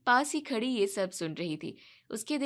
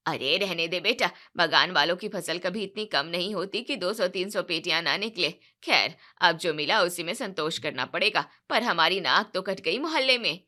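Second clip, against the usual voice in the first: lower, louder scornful reply; mother-in-law complaining of lost face; dismissive mother-in-law; 5 semitones lower, 4 dB louder than usual. Acted. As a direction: brush off the excuse with contempt.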